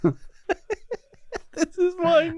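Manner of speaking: very high, slow, laughing mock presentation; mocking through laughter. Friends mocking the school talk through laughter. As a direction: stretch it out while cracking up.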